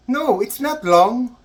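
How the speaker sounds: high, slow mock reassurance; puzzled correction. Canadian nurse misunderstanding the patient's cry, slow high correction.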